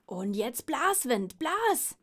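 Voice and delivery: loud drawn-out command, jumping high at the end; slow, loud call rising to a high cry. Acted. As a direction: shout at the wind to blow. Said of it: "rief der Mops".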